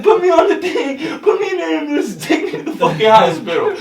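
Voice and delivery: can barely get the words out for laughing; laughing through quote. Retold or imitated. Retelling friend's demand, cracking up.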